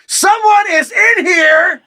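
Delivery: slow, high-pitched full shout. A narrator's reading. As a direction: yell it word by word through the door. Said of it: "I say louder".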